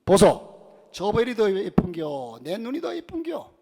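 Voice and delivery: a loud 보소 then a slow, rising Gyeongsang question; Gyeongsang wife. Retold or imitated. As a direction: call to the husband in Gyeongsang dialect. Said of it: "아내가 남편에게 묻습니다". (경상도 아내의 사투리 물음)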